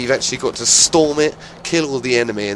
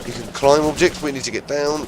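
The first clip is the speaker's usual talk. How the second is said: ordinary talk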